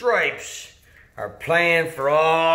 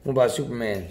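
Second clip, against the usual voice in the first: ordinary talk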